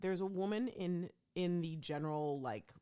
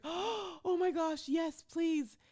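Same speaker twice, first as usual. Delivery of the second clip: excited relief; 12 semitones higher, 5 dB louder, much slower than usual. Retold or imitated. Grateful dad imitated.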